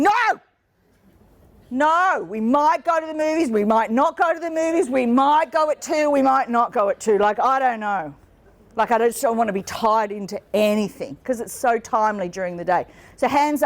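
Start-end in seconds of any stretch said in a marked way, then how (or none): 0.0-7.2 retold scream. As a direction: yell no, then rattle off the maybes a touch higher. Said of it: "I scream at him going".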